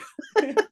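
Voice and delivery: short high-pitched laugh; amused burst of laughter. Real, not acted. Laughs at her own story.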